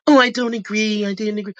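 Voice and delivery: mocking cast member. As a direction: mimic her lame excuse.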